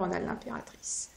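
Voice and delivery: card name repeated in a whisper. Real, not acted. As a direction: breathe the name, no voice at all.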